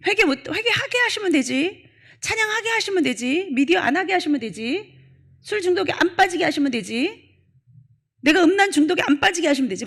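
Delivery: sarcastic whiny parody of excuses; mocking excuses. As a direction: mimic lazy believers passing the blame to God. Mocking excuses blaming God.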